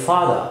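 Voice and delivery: FATHER ending "fah-thuh"; British father, no R; British silent R. Shown as a demonstration. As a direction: drop the final R in father. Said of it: "في البريتش إنجلش ما بتتنطقش".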